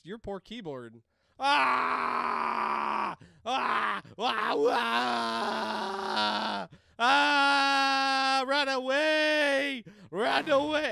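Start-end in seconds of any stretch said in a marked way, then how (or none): 1.4-6.7 repeated long loud screams; sustained high yelling; game scream. Pretend. Player screaming to survive the round, repeated screams. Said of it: "screaming to survive". 7.0-10.9 drawn-out high screamed warning; loud screaming. Pretend. Player fleeing in a game, screamed at full volume. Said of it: "Hopefully that's not too loud".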